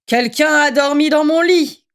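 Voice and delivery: grumbling father bear, put-on voice; storybook papa bear grumbling at his porridge; Papa Bear's big voice. A narrator's reading. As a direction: rumble it like a cross bear dad. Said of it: "gronda Papa Ours de sa grosse voix".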